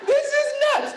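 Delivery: high, laughing disbelief; mock exasperation. Telling friends about her husband, high laughing disbelief. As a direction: squeal it in amused disbelief. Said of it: "I'm like".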